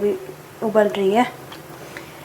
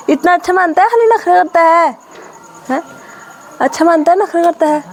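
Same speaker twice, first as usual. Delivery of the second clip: coaxing teasing; 7 semitones higher than usual. Real, not acted. Sister coaxing her crying brother, high playful teasing. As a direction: coax the sulking little brother.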